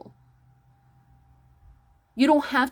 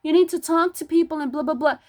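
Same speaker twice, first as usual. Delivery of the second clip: higher-pitched mimicry of critics; mock the people nagging you; mocking imitation; 5 semitones higher than usual. Imitating people who make fun of a loner, mocking. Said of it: "how many people make fun of me".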